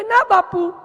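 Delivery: high, loud call back; loud answer. Acted. Servant shouting from kitchen to master, high and loud.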